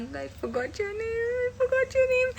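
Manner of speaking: high, drawn-out I forgot your name; sing-song apology. Real, not acted. (ऊँची गुनगुनाती झेंप भरी आवाज़)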